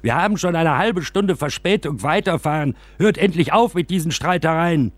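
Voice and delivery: high-pitched indignant shout from the windows; shouting passengers. Acted. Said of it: "und schrien".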